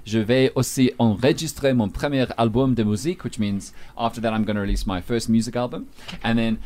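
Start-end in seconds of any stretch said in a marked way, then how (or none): 0.0-3.2 the full French sentence read with clean pronunciation; French demo. Shown as a demonstration. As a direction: model the French sentence for the listeners. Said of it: "I'll give you a sense".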